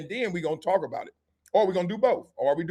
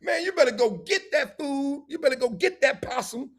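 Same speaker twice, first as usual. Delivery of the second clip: higher-pitched nagging, acted out; mock pleading in a raised pitch; imitated hungry spouse; 8 semitones higher than usual. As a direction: put on a high, insistent demand for food. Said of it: "You like".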